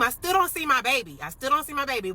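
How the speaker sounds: high, urgent repeated cry; retold panic. Mother walks into daughter's room, no baby, panicked. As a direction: re-enact mounting alarm with pitch pushed up.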